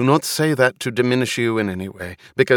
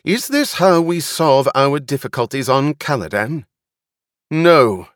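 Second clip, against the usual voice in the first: loud, higher-pitched public address; commanding speech pitched up over a crowd; raised voice; 5 semitones higher, 6 dB louder than usual. A narrator's reading. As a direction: address a crowd with stern authority. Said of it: "She raised her voice".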